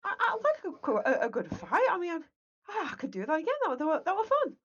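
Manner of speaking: high, loud happy brute; gleeful barbarian. Acted. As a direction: cheer like a battle-happy barbarian. Barbarian cheering after the fight.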